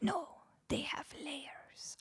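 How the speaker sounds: slow, whispered try at a Scottish ogre; hushed, botched Scottish ogre accent; attempted Scottish ogre. Streamer retelling the ogre's answer to Donkey, poor Scottish ogre accent. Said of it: "I cannot do a Scottish ogre accent".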